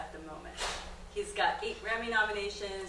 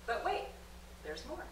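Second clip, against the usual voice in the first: infomercial catchphrase; 3 semitones higher, 5 dB quieter than usual. Pretend. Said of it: "think about story that way".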